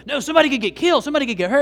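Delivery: high, loud worried cry of a rule follower; mock panic over safety; alarmed warning. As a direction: sound the alarm, somebody could get hurt. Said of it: "getting all red-faced with my kids".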